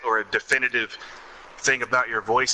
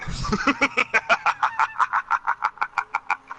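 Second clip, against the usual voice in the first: evil laugh. Acted. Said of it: "Let me show you this".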